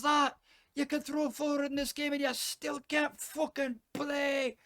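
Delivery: high, loud, ranting Scottish brogue; furious Scottish football fan yelling at the game; thick Scottish accent. Acted. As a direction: bellow insults at the telly in broad Scottish. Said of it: "you hear this thick Scottish accent".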